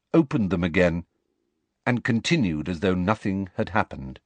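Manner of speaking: ordinary delivery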